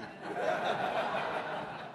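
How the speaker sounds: quiet breathy laugh at the absurd request; soft laugh. Real, not acted. Laughing softly at the stranger's request.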